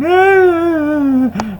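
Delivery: shrill high mocking cackle. Real, not acted. Laughing at the crying deleters in a high cackle.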